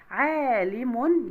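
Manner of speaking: slow عالم, said "ʿaaa-limun"; long ā vowel. Shown as a demonstration. Teacher showing madd with alif, عالم with held ā. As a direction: stretch the first ā of عالم. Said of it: "يبقى مد بالألف".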